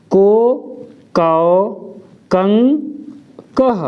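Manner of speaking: each syllable from क to कह said apart and clearly; slow syllable drill from क to कह; ka barakhadi. Shown as a demonstration. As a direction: recite the matra row for ka. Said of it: "अब एक बार देख लेते".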